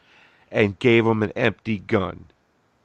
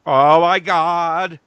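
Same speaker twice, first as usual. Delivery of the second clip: put-on Philadelphia accent; 7 semitones higher, 7 dB louder than usual. Friend echoes Hans begging in a Philadelphia accent. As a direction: beg for your life in a put-on Philadelphia accent. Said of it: "would that be the Philadelphia accent".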